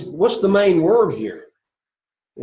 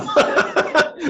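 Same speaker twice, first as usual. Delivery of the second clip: sudden high burst of laughter; 4 dB louder than usual. Real, not acted. Teacher amused at a puzzled class, laughing.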